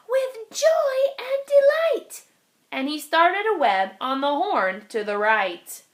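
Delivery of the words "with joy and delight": high, joyful little spider voice; a tiny spider accepting with delight. Acted. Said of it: "said the spider".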